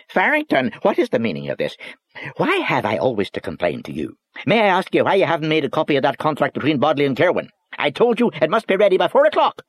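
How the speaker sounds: high, loud scolding Northern Irish voice; angry little boss. Acted. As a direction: tear into him from behind the desk. Boss berating clerk.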